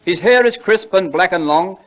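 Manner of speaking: slow, lofty recitation; poem recital. Acted. [name] reciting Longfellow to the audience, slowly and grandly. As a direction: declaim each line like a school prize reciter.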